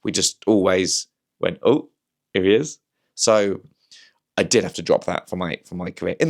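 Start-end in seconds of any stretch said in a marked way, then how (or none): 1.6-2.8 light Reading-accent impression of a comedian; a hint of Ricky Gervais, tossed off casually. Friends greeting each other, lightly imitating Ricky Gervais. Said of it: "me and all my friends would always talk like that".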